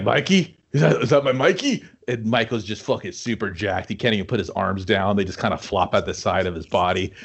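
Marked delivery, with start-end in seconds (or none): 0.0-1.8 lightly voiced frail old man, rising; a hint of a wheezy old dad seeing his son; mildly higher, wondering old man; old father voice.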